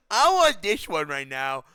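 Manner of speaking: put-on slow, demanding little kid; whiny kid voice. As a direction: beg like a greedy child. Childish demand repeated slowly.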